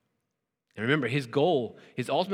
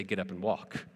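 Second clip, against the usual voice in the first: lower, much softer quiet authority on "Get up and walk"; soft command; 7 semitones lower, 8 dB quieter than usual. Pretend. Pastor voicing Jesus to the paralyzed man, hushed and low. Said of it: "I can't look at a paralyzed person and say".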